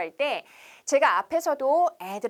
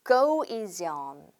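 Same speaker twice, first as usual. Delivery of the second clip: slow, careful "go easy on"; drawn-out teaching demo; slow model phrase; 5 semitones lower, much slower than usual. Shown as a demonstration. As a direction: lay out each word for the class to copy. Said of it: "한 번 더 할게요".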